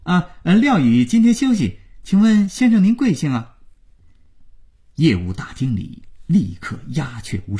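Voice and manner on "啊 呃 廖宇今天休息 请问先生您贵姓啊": higher-pitched cover-up on the phone; startled, quick-witted. A narrator's reading. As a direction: think fast and stay polite. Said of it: "廖宇一惊，机灵地回答".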